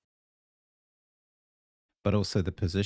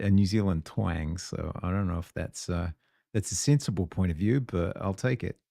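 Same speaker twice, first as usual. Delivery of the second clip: ordinary talk, nothing marked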